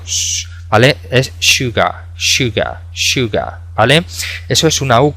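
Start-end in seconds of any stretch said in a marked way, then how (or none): 0.0-0.5 sh- unvoiced, as if hushing someone; sh sound. Shown as a demonstration. Teacher showing students the sh in sugar, hushed. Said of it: "como si fuésemos a mandar silencio". 1.1-3.6 sugar said as "shuga" with a soft sh. Shown as a demonstration. Teacher repeating the word for students, softly. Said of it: "esa S es una pronunciación un poco especial".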